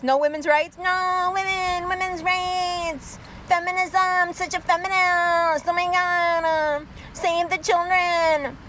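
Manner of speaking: mock feminist. Mocking feminist voice.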